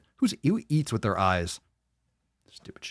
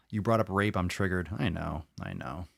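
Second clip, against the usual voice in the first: ordinary delivery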